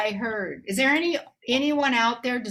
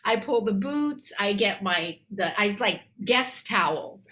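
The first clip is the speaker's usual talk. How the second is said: ordinary talk, nothing marked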